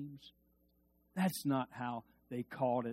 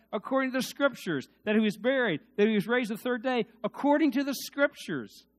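preaching scripture; 8 semitones higher, 6 dB louder than usual. Real, not acted. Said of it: "preaching scripture at full force".

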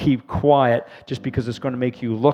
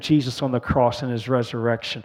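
prayer; 3 semitones higher, 5 dB louder than usual. Real, not acted. Prayer for newcomers.

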